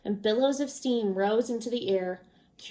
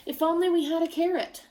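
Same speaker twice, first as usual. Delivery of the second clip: higher, sly longing hint; wistful hint; 7 semitones higher, faster than usual. A narrator's reading. Traveler slyly hinting to the crowd, wistful and high. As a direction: dangle the wish in front of them. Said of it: "he paused and sighed".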